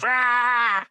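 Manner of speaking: high drawn-out "aah" of exasperation; mock scream. Ignored dad losing it with his kids, mock scream. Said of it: "you're screaming back as well".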